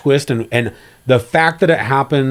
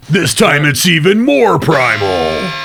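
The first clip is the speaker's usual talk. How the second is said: mock trailer announcer, punchy and dramatic; hammed-up trailer narrator voice; movie trailer voice; 2 semitones higher than usual. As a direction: sell it like a blockbuster tagline, tongue in cheek.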